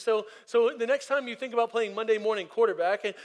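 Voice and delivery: urgent call to action from the pulpit; preaching push. Acted. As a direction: drive it home to the congregation. Builds to a charge.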